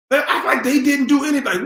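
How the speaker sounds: fast, mocking singsong; mock their innocent act. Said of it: "repeated line".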